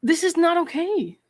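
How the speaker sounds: lingering in disbelief; shocked and disturbed; appalled. Real, not acted. Reacting to creepy message.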